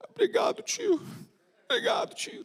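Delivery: high whispered little thank-you; a tiny, shy boy thanking; child whisper. Acted. (agradecimento sussurrado de criança)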